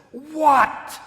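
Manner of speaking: loud, high, indignant adolescent What; exasperated teen What. Retold or imitated. Ten-year-old son snaps at his mom, exasperated loud teen What. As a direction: fling it at mom like an annoyed teenager.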